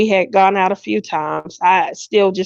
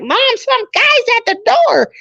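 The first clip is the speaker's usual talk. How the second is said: imitating young son. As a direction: play the son calling to mom. Son announcing visitor.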